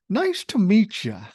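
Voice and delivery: put-on high, loud, drawn-out child voice; imitated little girl. Granddaughter to the old man in the hall, imitated high and loud. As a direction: imitate a confident small child calling it out slowly. Quoting granddaughter's cheeky parting line.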